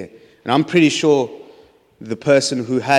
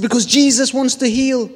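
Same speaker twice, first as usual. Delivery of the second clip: blurted answer; 11 semitones higher, 3 dB louder than usual. Retold or imitated.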